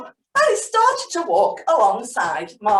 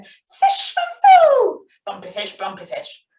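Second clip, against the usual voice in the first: demo sound; 3 semitones lower, 6 dB louder than usual. Shown as a demonstration. Teacher demonstrates adding sounds for children, playful. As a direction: show how to voice it. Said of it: "put a little sound with it".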